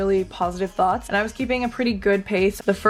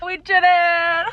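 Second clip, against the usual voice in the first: breathless excitement; 8 semitones higher, slower than usual. Real, not acted. Just finished the run.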